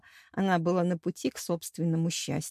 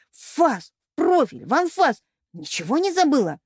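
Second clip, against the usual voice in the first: raised-pitch indignant listing; raging; 5 semitones higher, 3 dB louder than usual. A narrator's reading. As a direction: fire off each item in outrage. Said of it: "продолжала неистовствовать Пенелопа". (с яростным возмущением)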